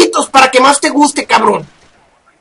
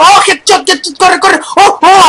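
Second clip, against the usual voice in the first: urgent high cries while running; panicked shouts mid-chase; being chased; 5 semitones higher than usual. Real, not acted. Fleeing enemies in the game. (gritos urgentes huyendo)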